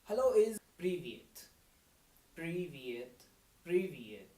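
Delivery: привет said clearly, syllable by syllable; Russian pronunciation demo. Shown as a demonstration. Teacher to students, modelling привет carefully. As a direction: model привет for learners to repeat. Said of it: "how to say hello in Russian language?".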